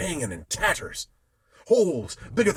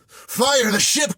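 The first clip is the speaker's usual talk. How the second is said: high, loud, slow shout; crying out; 13 semitones higher, 8 dB louder, slower than usual. A narrator's reading. Sailor urging the crew to fire, shouting. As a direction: scream the order. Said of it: "cries I".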